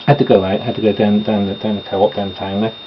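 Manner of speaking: ordinary talk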